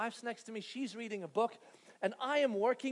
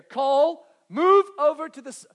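loud, higher urgent calls; calling for help; 5 semitones higher, 13 dB louder than usual. Retold or imitated. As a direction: shout for the husband to come help. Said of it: "And then I hear".

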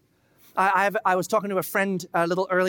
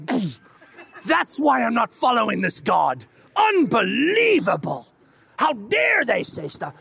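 louder, slow indignant shout; scoffing outrage; 4 semitones higher, 5 dB louder, slower than usual. Retold or imitated. Reader rejecting God over Scripture, loud scoffing outrage. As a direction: scoff then shout it down in disgust.

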